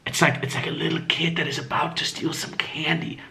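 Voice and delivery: hushed, furtive undertone; secretive. Shown as a demonstration. Showing how guys approach in public, hushed and secretive. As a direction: drop to a sneaky near-whisper. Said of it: "they make it secretive".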